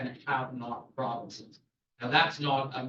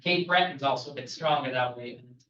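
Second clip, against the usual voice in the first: normal talking voice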